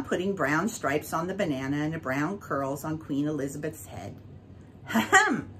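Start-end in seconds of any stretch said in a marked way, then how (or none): 4.9-5.5 ladylike cough. A narrator's reading. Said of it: "with a ladylike cough".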